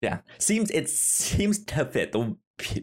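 ordinary talk, nothing marked

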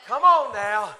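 loud, high, shouting sermon climax; preaching at full force. Acted. Preacher urging the church to have faith, shouting. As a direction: preach it out with everything, raising the roof.

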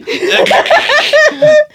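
shrill laugh staying in the squeaky voice; giddy high-pitched laugh; high falsetto cackle. Pretend. Laughing inside the Geordie voice.

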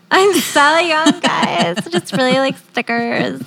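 hammed-up youthful gushing; mock cutesy. Mock answer to being young.

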